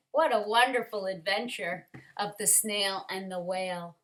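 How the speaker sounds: ordinary delivery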